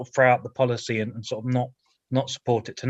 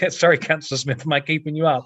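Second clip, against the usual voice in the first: teasing; 4 semitones higher, 3 dB louder than usual. Real, not acted. Teasing a yawning colleague.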